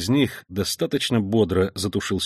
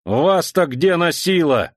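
loud, slow, high outrage; indignant; 7 semitones higher, 4 dB louder, slower than usual. Acted. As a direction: demand it indignantly. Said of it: "возмутился старший лейтенант".

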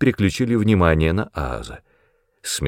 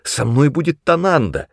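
higher-pitched objection; insistent protest, pitched up; 7 semitones higher than usual. A narrator's reading. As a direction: argue back eagerly. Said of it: "возразил я".

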